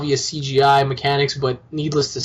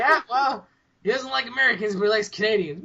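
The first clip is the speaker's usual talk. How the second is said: higher, softer mock quote; mocking imitation of friend; 5 semitones higher, 5 dB quieter than usual. Host mocks friend inventing a rumour, mocking imitation. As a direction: put on the friend making it up. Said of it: "He was like".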